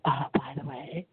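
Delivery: whispered to the side, low; a quick whispered by-the-way; whispered aside. Real, not acted.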